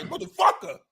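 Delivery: high yelped curse like an angry coach; mock coach outburst. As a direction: squeal it out as the fuming coach. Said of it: "a coach was like".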